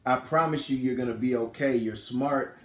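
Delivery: acted-out hushed comfort; retold tearful reassurance. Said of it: "and she's crying, and she's like".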